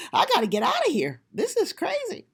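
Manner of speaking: half-laughing panic, retold; freaked-out exclaim. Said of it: "And I'm like".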